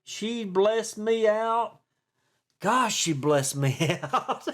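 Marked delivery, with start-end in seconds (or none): 2.6-4.5 breaking into laughter mid-phrase; slow words collapsing into a laugh. Real, not acted. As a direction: crack up while saying it. Laughing over being cussed out.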